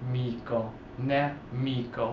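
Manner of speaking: amico, nemico split slowly, "a-MEE-ko, neh-MEE-ko"; stress on MI. Shown as a demonstration. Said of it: "the stress i-, is correct".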